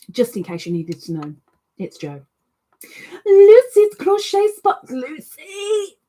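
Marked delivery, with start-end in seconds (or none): 3.2-6.0 much higher and loud, calling Lucy; excited call. Real, not acted. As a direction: greet her with a happy cry.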